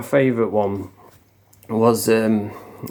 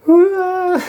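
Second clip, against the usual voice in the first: put-on girlish scream. Troll pinned on the floor, screaming like a little girl. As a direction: imitate a little girl's squeal. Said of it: "screaming like a little girl".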